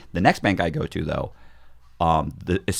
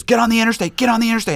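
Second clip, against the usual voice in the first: high, urgent repeated plea; urgent wife; 12 semitones higher, faster than usual. Retold or imitated. Wife urging him to run for the highway, high and urgent. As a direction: push it high and pleading.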